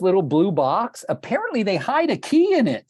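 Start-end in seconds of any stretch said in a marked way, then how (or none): 1.1-2.8 high, far-off mock voice of a clueless homeowner; mocking clueless lady. To the agent, imitating the seller's clueless lockbox remark in a high voice. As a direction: squeak it out like she's never heard of a lockbox. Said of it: "This is the lady talking".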